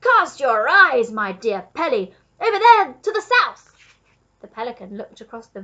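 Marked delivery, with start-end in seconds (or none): 0.0-3.9 loud, slow, drawn-out direction; calling out. A narrator's reading. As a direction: grandly proclaim it across the estate. Duke directs pelican to river.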